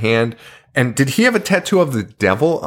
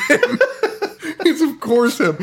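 laughing; 13 semitones higher than usual. Real, not acted. Laughing at mugshot.

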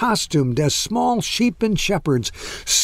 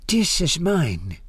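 put-on slow accented old woman; accented English; 3 semitones higher than usual. A narrator's reading. Old woman claims her tapestry, slow foreign accent. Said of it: "in accented English".

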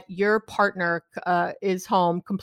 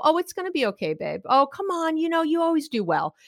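breezy, a little higher brush-off; dismissive reassurance; 6 semitones higher than usual. Retold or imitated. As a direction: cheerfully wave the worry away. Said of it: "I didn't go".

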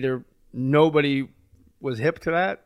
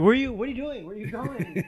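frantic calling; 10 semitones higher than usual. Retold or imitated. Frantic handler calling after comic.

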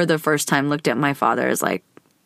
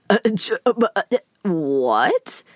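stunned; 3 semitones higher, slower than usual. Retold or imitated. Stunned reply to dad.

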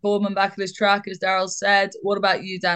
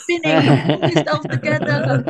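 imitated dad; 2 semitones lower, 4 dB louder than usual. Presenter retells dad's telling-off to co-hosts, imitated dad. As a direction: laughingly act out an exasperated dad. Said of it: "he was like".

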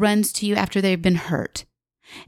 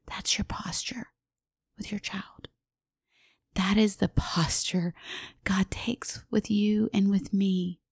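whispered and much softer; an intimate, soft whisper to the congregation; hushed whisper; 6 dB quieter than usual. Real, not acted. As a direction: drop to a breathy whisper to draw them in. Intimate point made in a hush.

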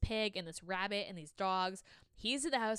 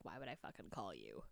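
snappy retort; 15 dB quieter than usual. Retold or imitated. She snaps back at him.

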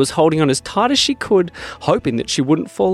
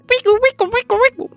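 very high squeaky wickle; squeak toy imitation; 3 dB louder, faster than usual.

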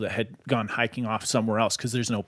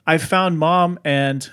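drawn-out, louder, higher imitated call; retold yell; 5 semitones higher, 6 dB louder, slower than usual. Eight-year-old boy calls back to his dad, retold yell. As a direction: mimic calling back to the others. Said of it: "he kinda turns back and yells".

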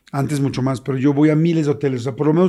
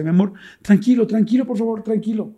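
imitated soothing; 6 semitones higher than usual. Partner to the man collapsed on the floor, high soothing voice. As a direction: act out a partner calming someone in panic.

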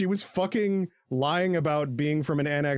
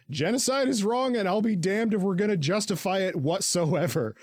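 heroic declaration; 6 semitones higher than usual. Retold or imitated.

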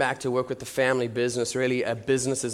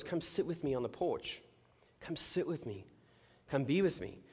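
soft, patient invitation; God's gentle voice; 9 dB quieter than usual. Retold or imitated. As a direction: quietly beckon him to rest. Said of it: "his word was".

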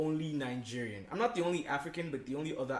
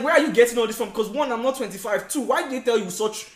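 much louder and higher; indignant outburst; 8 semitones higher, 12 dB louder than usual. Retold or imitated. To the coworker gossiping about his age, loud indignation. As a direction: demand answers, indignant. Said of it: "I'm like".

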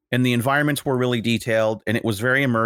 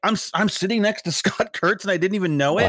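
higher-pitched, imitated amazement; starstruck excitement; 8 semitones higher than usual. Imitating an artist amazed at his lunch neighbour, starstruck excitement. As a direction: mimic a thrilled fan.

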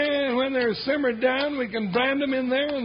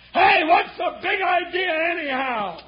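loud angry call; shouting; 5 semitones higher, 3 dB louder than usual. Acted. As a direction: yell it out in protest. Shouting at shooter.

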